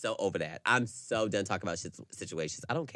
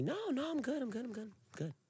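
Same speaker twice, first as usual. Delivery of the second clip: mock chirpy reassurance; 10 dB quieter than usual. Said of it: "Ricky, are you mad at me?".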